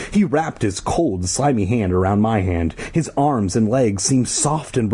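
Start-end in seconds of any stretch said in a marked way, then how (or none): none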